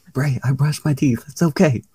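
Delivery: high, playful protest; imagine pleading your case, lightly; mock reassuring. Cameraman wanting to reassure Bray Wyatt, mock earnest.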